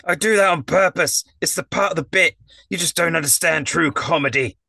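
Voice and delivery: high, indignant put-on voice; squeaky misunderstood artiste; offended clown. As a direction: defend the act, wounded and haughty.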